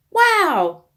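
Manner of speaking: high, loud wondering wow; amazed wow. Acted. Girl seeing two new squash, amazed wow. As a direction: gasp it out in delight. Sophie sees the baby squash.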